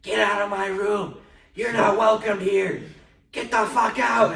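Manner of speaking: high, drawn-out full-force yell; slow, loud, high-pitched shouting. Retold or imitated. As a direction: imitate an aunt screaming someone out. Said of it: "yelling at something".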